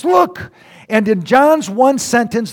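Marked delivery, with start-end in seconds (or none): 0.0-0.5 high excited look; jazzed exclamation. Shown as a demonstration. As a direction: burst out thrilled. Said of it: "John was jazzed about Jesus".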